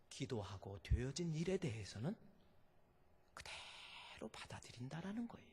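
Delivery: hushed. Acted. Preacher quietly leaning in to the congregation, hushed. Sudden drop after shouting.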